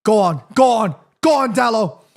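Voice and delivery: ordinary talk, nothing marked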